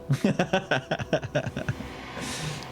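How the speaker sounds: long amused laugh. Real, not acted. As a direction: laugh it out in a long trailing run. Amused reaction to the game.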